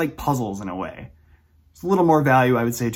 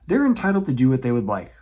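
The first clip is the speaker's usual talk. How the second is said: normal talking voice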